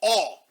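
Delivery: "All" said alone, loud and flat; mocking "All". Shown as a demonstration. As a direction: hold up the lone word "All" in disbelief. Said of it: "started the sentence with all".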